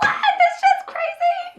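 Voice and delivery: high, loud astonished squeal; amazed outburst. Retold or imitated. Spotting the coat from the film, high amazed outburst. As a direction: burst out incredulous, pitch soaring.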